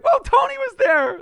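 high, loud amazed gasp; sudden awed exclamation; surprised. Retold or imitated. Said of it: "I was like".

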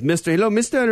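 mock Indian accent. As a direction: greet as an Indian-accented phone scammer. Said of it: "with a strange Indian accent".

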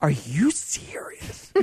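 very slow stage whisper; mock-horrified whispered question, drawn out; ridiculous disbelief. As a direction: whisper it as if utterly stunned. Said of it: "And I was ridiculous".

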